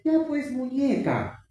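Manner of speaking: slow, high, sugary mother voice; mock doting mother. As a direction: coo it like a mother who gives in. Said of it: "Y la mamá le dice".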